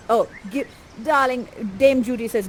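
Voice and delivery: normal talking voice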